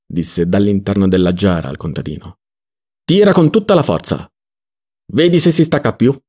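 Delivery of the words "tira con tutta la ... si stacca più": higher, commanding call; urgent order. Acted. Ziddima to the peasant from inside the jar, urgent order.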